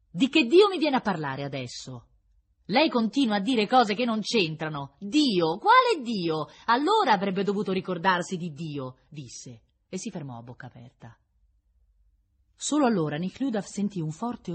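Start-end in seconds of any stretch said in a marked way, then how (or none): 0.1-9.0 loud, high, indignant retort; scornful anger. A narrator's reading. Prisoner scorning the prince's talk of God, indignant. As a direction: rail at him with scornful questions.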